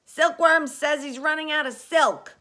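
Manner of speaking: loud, high call from below; high-pitched urgent yell. A narrator's reading. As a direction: yell up with bad news. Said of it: "yelled the centipede from below".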